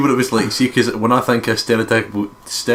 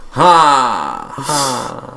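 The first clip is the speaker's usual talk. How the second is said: imitating her laugh; 2 semitones higher than usual. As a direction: bark out her hearty laugh. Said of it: "She's like".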